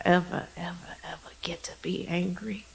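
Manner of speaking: whispered guidance. Acted. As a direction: whisper it close. Whispered in meditation.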